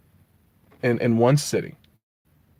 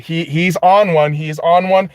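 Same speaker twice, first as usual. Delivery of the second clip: usual speaking voice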